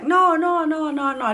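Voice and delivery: high, loud run of protests; retold frantic brushing-off of the nurse; insistent denial. Patient denying pregnancy to the nurse, insistent.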